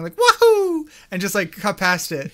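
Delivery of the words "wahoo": high loud Mario wahoo. Retold or imitated. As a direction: cheer like Mario. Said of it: "Mario going".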